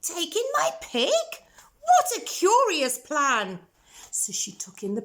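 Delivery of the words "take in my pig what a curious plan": put-on high, questioning old lady voice; old lady baffled by the advice; puzzled old lady. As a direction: query the odd plan, high.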